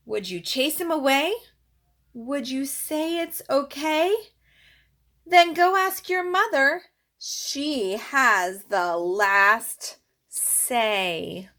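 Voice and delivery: lively, singsong picture-book read-aloud; reading to children. Acted. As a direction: read it to a little one, bouncing the rhymes.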